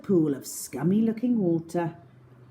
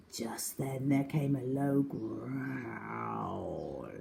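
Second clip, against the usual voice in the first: lower, softer, slow and ominous; ominous hush; 7 semitones lower, 8 dB quieter, much slower than usual. A narrator's reading. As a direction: build dread. Tiger appears.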